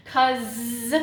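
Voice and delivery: "Cuz" with a long buzzing z at the end; phonics-style "Cuz", ending held; held z sound. Shown as a demonstration. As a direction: stretch the final z of "Cuz". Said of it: "an S instead of a Z".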